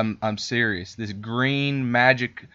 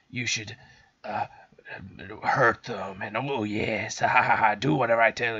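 evil witch cackle. Retold or imitated. Evil book lady commanding the boy, wicked and gloating. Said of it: "she's just like".

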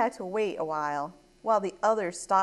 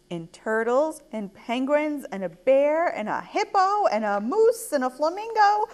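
excited storytime list, lifting on each item; building excitement; 6 semitones higher, 4 dB louder than usual. A narrator's reading. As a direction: list climbing higher and louder. Listing animals with mounting excitement.

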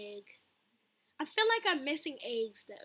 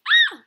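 loud, very high shriek; startled scream; 16 dB louder than usual. Real, not acted. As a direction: shriek as the monster appears. Startled during scary game.

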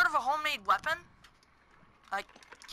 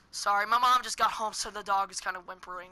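ordinary talk

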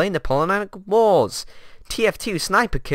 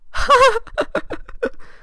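high laugh. Acted. Bursts out laughing.

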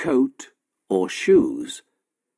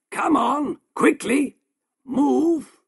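higher, impatient angry push; angry urging; 13 semitones higher, slower than usual. A narrator's reading. Shopkeeper drives Merrick across the room, angry and impatient. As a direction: hurry him along with contempt. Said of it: "said the shopkeeper angrily".

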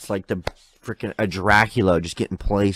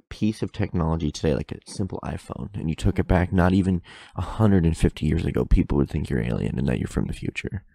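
usual speaking voice

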